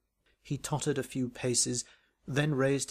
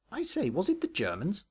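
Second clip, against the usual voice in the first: higher, piping schoolboy question; a piping Bunter voice; piped; 7 semitones higher than usual. A narrator's reading. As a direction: pipe it up like Bunter. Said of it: "piped Bunter".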